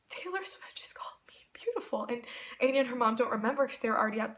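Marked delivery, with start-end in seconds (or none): 0.1-2.1 hushed whisper in disbelief; stunned whisper. Retold or imitated. Fan telling how she left the room, stunned whisper. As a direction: whisper it as if too stunned to speak. Retelling her stunned reaction after meeting.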